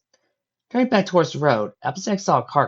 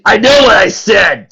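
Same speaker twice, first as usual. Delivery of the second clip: slow, louder snarl; 8 semitones higher, 12 dB louder, slower than usual. Acted. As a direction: snarl the threat. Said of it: "the mugger snarled".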